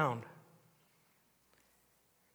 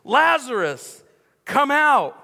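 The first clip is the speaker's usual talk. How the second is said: a big, drawn-out shout into the tomb, higher and louder; loud call; 7 semitones higher, 7 dB louder, slower than usual. Acted. Jesus calls Lazarus from the tomb, loud summoning shout. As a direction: call him out of the grave at full voice. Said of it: "called out in a loud voice".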